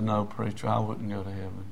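low, soft retold answer; subdued reply. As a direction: imitate a man quietly admitting it. Dying man's resigned reply, retold.

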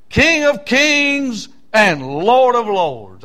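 loud, high, slow pulpit shout; slow ringing cry of a preacher; shouted proclamation. Acted. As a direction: shout it out majestically, stretching each title. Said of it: "he's shouting it in this passage of Scripture".

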